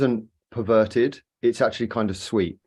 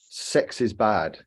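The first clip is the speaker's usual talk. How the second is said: slow mock quote; 3 dB quieter, much slower than usual. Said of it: "light worker myth".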